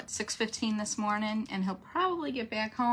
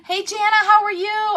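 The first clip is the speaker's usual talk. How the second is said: much higher and louder, bright hello; excited greeting; 12 semitones higher, 12 dB louder than usual. Real, not acted. Host greets viewer Jana, excited and loud. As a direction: greet her like a friend just walked in.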